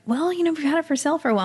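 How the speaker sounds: quick, high, offhand dismissal, half-mocking himself; breezy brush-off. Brushing off the realtor's question with a quick, high, offhand reply. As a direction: rattle it off lightly like it's nothing. His own reply retold.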